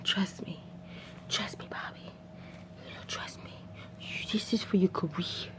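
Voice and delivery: hushed persuasive whisper; unvoiced soft whisper. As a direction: whisper the pitch softly. [name] whispering advice.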